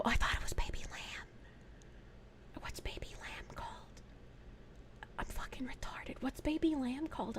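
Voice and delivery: near-whispered puzzling aside; confused muttering to self. Real, not acted. Streamer puzzling over baby lamb to herself, very quiet whispered muttering. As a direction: whisper it very softly, as if thinking aloud.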